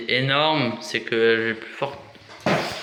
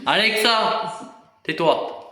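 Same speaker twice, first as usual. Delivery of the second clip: annoyed shout; 7 semitones higher, slower than usual. Real, not acted. Yelling at Alexa.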